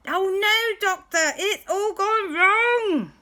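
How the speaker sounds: hammed-up cry that it's gone wrong; mock panic. Everyone panicking, landing in sea.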